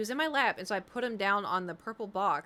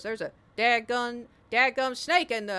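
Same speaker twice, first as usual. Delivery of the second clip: lightly voiced excited old hunter; loud old country guy shouting; old Southern man; 3 semitones higher, 8 dB louder than usual. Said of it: "a really old dude".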